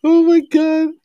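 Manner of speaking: high squeal of disbelief; amused disbelief. Real, not acted. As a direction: shriek it in amused shock. Reacting to friend's answer.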